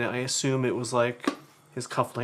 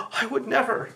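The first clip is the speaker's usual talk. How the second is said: mother's innocent voice, pitched much higher; imitating mother; 12 semitones higher than usual. Mother brushes off siblings' objection, in high mock innocence. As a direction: act the mother breezily denying it. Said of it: "She's like".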